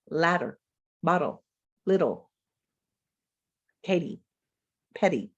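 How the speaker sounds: Ladder, bottle, little with a quick tapped middle; tongue flicks up and down in the middle; flap sound. Shown as a demonstration. Teacher modelling the English flap for students. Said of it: "say these words to yourself".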